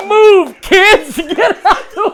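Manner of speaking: very high, loud shout through laughter; repeating his friend's joke, shouting and laughing. Retold or imitated. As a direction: yell the joke back, cracking up. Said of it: "He said".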